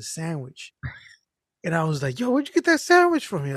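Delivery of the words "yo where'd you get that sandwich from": surprised. Retold or imitated. Surprised friend asks about sandwich.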